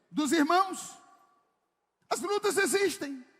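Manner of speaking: loud, driving sermon delivery; full-force preaching. Acted. (pregação com toda a força)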